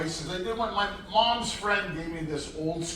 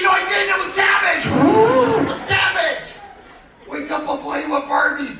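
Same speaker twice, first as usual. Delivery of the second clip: much higher, loud panicked shouting back; frantic shout; 13 semitones higher, 11 dB louder than usual. Retold or imitated. Defending himself to the yelling mother, loud high shout. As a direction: yell your defense in a panic.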